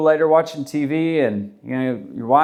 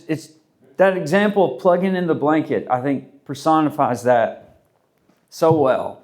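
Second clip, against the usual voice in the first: ordinary talk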